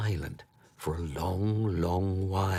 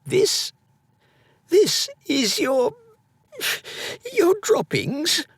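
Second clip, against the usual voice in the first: very high, halting gasp of disbelief; stunned disbelief; slower than usual. A narrator's reading. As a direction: squeak it out in shocked disbelief. Edwin shocked at the nugget.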